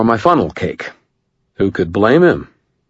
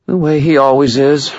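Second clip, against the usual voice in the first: higher, dry woman's voice; Murphy voice; 9 semitones higher, 4 dB louder than usual. A narrator's reading. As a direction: voice Murphy, wry. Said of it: "Murphy said".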